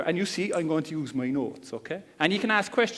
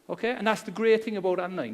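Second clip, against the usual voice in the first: ordinary talk, nothing marked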